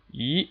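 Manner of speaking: E rising steadily; second tone. Shown as a demonstration. Teacher shows learners the second tone, steadily rising E. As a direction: slide E upward with no dip at all. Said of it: "rising all the way up steadily".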